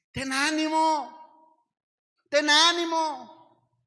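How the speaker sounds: high, loud and slow rallying cry; fervent shout. Acted. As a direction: shout courage into the crowd. Preacher shouts Jesus' encouragement.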